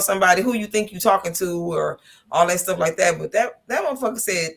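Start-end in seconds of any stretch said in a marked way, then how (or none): none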